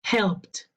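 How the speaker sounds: "helped" in one syllable ending in a t sound. Shown as a demonstration. Teacher models the voiceless rule, "helped" closing on t. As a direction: say "helped" as "helpt". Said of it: "E D, se pronuncia".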